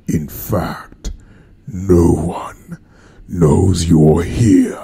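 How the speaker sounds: slow, low, hushed ominous figure; impression. Shown as a demonstration. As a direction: deliver a slow, menacing pronouncement with long pauses. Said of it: "What was that one I used to do".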